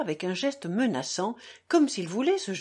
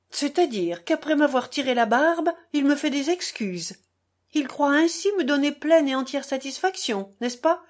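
higher-pitched incredulous scoffing; raised, affronted sarcasm; indignant irony; 7 semitones higher, 5 dB louder than usual. A narrator's reading. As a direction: question the apology with mock disbelief. Captain scoffs at offered apology.

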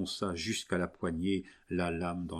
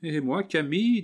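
high, loud mocking question; raised, teasing voice rising at the end; amused, laughing; 8 semitones higher, 5 dB louder than usual. A narrator's reading. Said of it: "dit en riant Suzanne".

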